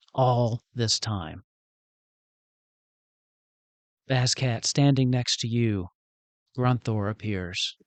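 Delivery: measured, stately storytelling with weighty pauses; game master unveiling a big moment; solemn scene narration. A narrator's reading. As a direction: let each phrase land before the next. Game master narrates fallen allies gathering.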